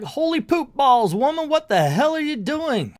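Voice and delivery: high, loud mock shocked yell; shocked shout. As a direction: yell it in hammed-up disbelief. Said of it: "And everybody's like".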